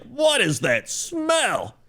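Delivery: put-on high, slow old lady asking; high and slow mock old woman; old lady's voice. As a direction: squeak it out like a fussy old lady. Old lady complaining in story.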